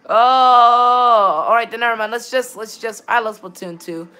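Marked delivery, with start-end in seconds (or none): 0.1-1.8 loud, slow, stretched all right then; drawn-out catchphrase. Real, not acted. Streamer giving up on the lobby, slow loud drawl. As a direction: drag the phrase out loud.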